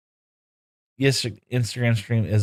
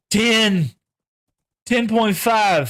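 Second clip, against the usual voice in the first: excited; 10 semitones higher, 4 dB louder, much slower than usual. Real, not acted. Excited at horse speed stat.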